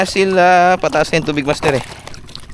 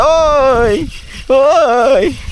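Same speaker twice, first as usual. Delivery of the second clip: quick high calls at the strike; excited calls; 8 semitones higher than usual. Real, not acted. Angler reacting to a fish on, excited. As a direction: yelp as the rod bends.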